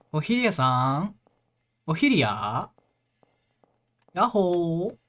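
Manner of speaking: loud high calling. Real, not acted. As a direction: call across the house, loud. Calling for Ophelia in game.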